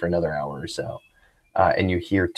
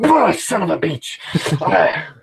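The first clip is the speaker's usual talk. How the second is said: outraged curse; 9 semitones higher, slower than usual. Acted. Coffee kicked from Tuco's hands.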